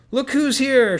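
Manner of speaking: loud, high excited shout. A narrator's reading. Victor greeting the newcomers with a loud shout. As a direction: yell it out in greeting. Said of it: "shouted Victor".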